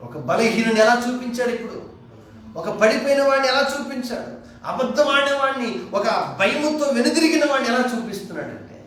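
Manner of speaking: loud, high preaching cry; full-force preaching. Acted. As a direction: thunder it out to the congregation.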